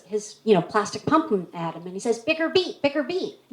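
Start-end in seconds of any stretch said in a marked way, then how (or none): 2.2-3.4 "Bicker beat, bicker beat" said with B for "Trick or treat", repeated higher; impatient kid mangling "bicker beat"; insistent kid's speech impediment. Retold or imitated. Trick-or-treater repeating "bicker beat" while shaking his pumpkin. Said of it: "little problem with speech".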